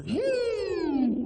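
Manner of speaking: loud high siren whoop; police siren. Retold or imitated. The highway patrol coming up behind, a loud siren whoop. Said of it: "I hear".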